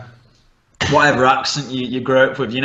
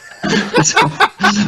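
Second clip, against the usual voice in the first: high burst of laughter; 3 dB louder than usual. Real, not acted. Laughing at bastard joke.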